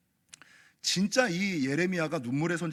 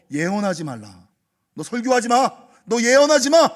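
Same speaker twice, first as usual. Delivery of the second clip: building from firm to yelled warning; angry mob shout; 4 semitones higher, 12 dB louder than usual. Acted. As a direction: bark it louder at each line. Townspeople threatening Jeremiah.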